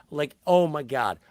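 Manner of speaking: normal talking voice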